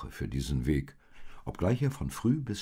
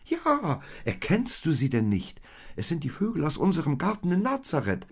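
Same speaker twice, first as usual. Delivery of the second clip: high, fast eager chatter; excited; 11 semitones higher, 5 dB louder than usual. Acted. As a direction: rush it out with glee. Maria excitedly explains to Josef.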